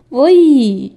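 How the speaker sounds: loud exclamation of disgust. A narrator's reading. Woman faced with pineapple compote, disgusted. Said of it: "با انزجار گفتم".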